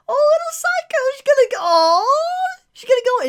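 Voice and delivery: very high, loud cooing; gushing squeal. Real, not acted. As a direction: squeal over a tiny adorable character. Gushing over a cute character.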